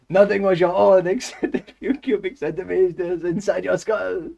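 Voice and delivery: loud, high-pitched hammed-up French accent; outrageous French voice at full volume. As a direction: belt it out as a comic Frenchman. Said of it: "I can do the French accent".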